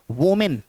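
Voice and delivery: वोमेन said "wo-min" with an O; wrong pronunciation. Shown as a demonstration. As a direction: give the first vowel of वोमेन an O sound, wo-min. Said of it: "ये गलत pronunciation है".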